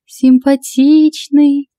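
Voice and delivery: high, very slow drawn-out appraisal; stretched teasing admiration; flirty purr. A narrator's reading. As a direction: savour the word like a tipsy flirt. Drunk Lada eyes the newcomer.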